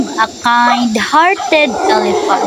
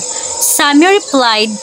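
ordinary delivery, nothing marked

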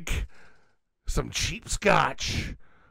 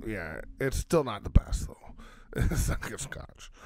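soft whisper, lower; whispered aside; 5 semitones lower, 5 dB quieter than usual. Real, not acted. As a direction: confide it under your breath. Whispered aside about cheap scotch.

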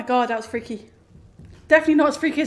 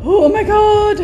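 terrified; 8 semitones higher, 9 dB louder, slower than usual. Real, not acted. Scared in chase.

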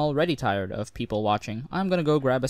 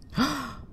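sharp, high theatrical gasp; mock gasp; 12 semitones higher than usual. Agent gasps at the recruit's teasing, mock shocked. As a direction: gasp as if scandalized, all for show. Mock outrage at being called nosy.

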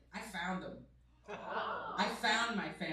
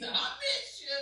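high, hammed-up mad bark; resting dick voice; 4 dB louder, slower than usual. Dad saying he misses his kid, mock-angry holler. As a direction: make affection sound like an attack. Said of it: "like, resting dick voice".

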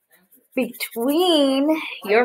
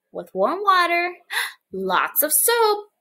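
ordinary talk

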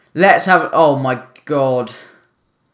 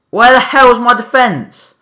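angry shout; 9 semitones higher, 7 dB louder than usual. Real, not acted. Defense fails again.

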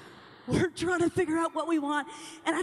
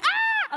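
imitated singer's cry; 4 dB louder than usual. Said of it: "She was like".

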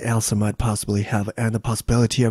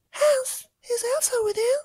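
high, soft tearful voice; crying Elsa; 5 dB quieter than usual. Acted. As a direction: whimper it out, close to tears. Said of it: "knocked at the window and cried".

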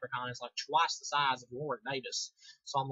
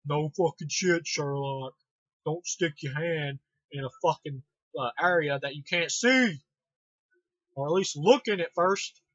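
mock scolding; 5 dB louder, slower than usual. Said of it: "and I'm like".

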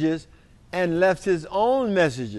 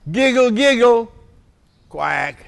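higher, louder showman read; lively title announcement; 4 semitones higher, 3 dB louder than usual. Acted. Reader announcing the book title to children, higher and bright. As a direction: present the title with a flourish.

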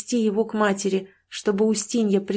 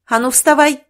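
higher, louder barked order; angry command; 6 semitones higher, 8 dB louder than usual. A narrator's reading. Sister ordering her brother out of bed, angry shout. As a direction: jolt him off the bed. Nyusya kicking brother awake.

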